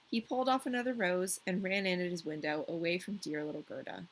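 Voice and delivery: ordinary delivery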